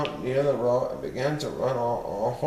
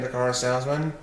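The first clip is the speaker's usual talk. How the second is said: ordinary delivery, nothing marked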